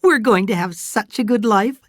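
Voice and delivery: carried away with glee; lightly voiced tipsy delight; merry and excited. A narrator's reading. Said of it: "Fay laughed".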